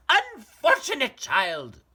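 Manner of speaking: very high, loud, impatient mother; scolding mother. Acted. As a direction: snap at a tiresome child.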